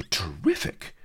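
breathless whisper; awed hush; whispered awe. Acted. Awed aside.

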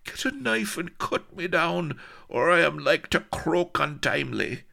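high, strained begging; groaning plea. A narrator's reading. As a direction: beg for release through the pain. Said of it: "groaned the pendant rascal".

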